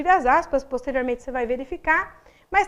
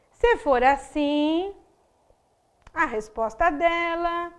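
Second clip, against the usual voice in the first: slow, with "assim" and "dela" drawn out and left hanging; trailing suspension; slower than usual. Shown as a demonstration. Teacher showing an open sentence, stretching "assim" and "dela" into suspense. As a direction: leave a long gap after "assim", then let "dela" hang unfinished. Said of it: "Só que ela fica em aberta".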